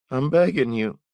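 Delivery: high, loud desperate begging; a ragged thief pleading at gunpoint. Acted. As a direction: beg for your life in a strained high voice. Thief pleading for his life.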